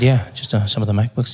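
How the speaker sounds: low confiding hum; confidential voice. Acted. Customer naming laptops to shop assistant, low confidential voice. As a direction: keep the answer low and private. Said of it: "if you speak softly or if you whisper".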